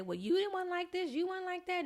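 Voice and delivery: high-pitched imitated nitpicking; mock objection. Someone throws past choices back at her, high mocking voice.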